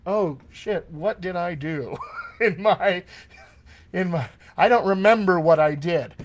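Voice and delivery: laughing, half-played dismay; mock panic. Acting actor who forgot the voice.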